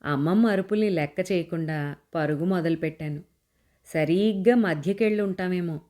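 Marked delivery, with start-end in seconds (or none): none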